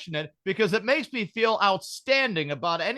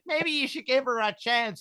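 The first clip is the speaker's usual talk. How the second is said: doting mother; 5 semitones higher than usual. Retold or imitated. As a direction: coax gently like a doting mother.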